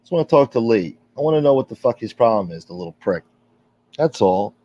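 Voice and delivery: ordinary delivery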